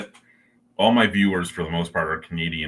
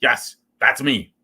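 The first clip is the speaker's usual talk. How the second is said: emphatic agreement; 7 semitones higher, 9 dB louder, slower than usual. Retold or imitated. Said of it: "She goes".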